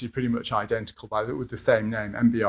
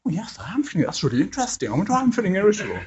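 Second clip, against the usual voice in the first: higher, faster, mock-sincere musing; mock mindful client; 9 semitones higher, much faster than usual. Client mockingly echoing the therapist's question, in a higher, faster voice. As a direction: parody a client parroting therapy talk. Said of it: "And the person goes".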